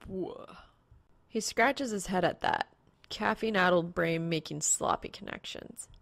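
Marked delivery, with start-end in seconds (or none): none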